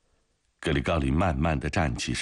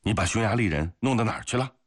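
fast, higher-pitched demand; sharp interrogation; 7 semitones higher, faster than usual. A narrator's reading. Comrade confronts the guard over the prisoner, questioning sharply. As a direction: press him hard for an answer. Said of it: "走过去质问他".

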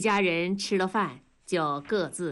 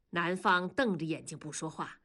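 plain reading voice

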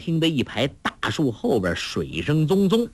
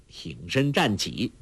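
ordinary delivery, nothing marked

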